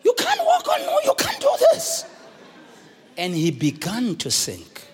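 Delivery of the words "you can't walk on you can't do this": doubting Peter. Acted. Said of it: "Then he says".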